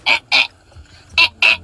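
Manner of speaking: short paired "eh" grunts; imitated teasing noise. Mimicking forklift driver's noises, short repeated grunts. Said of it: "making noise like this".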